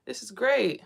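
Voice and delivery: high, delighted mock bliss; a lifted, happy okay woo. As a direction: sing out the woo in relief. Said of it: "I'm like".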